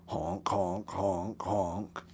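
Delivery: low, soft animal honking; quiet low porcupine honk calls. Acted. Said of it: "His calls became more strong".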